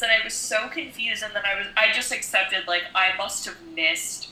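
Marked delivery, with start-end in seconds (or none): none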